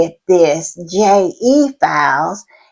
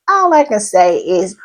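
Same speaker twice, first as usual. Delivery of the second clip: ordinary delivery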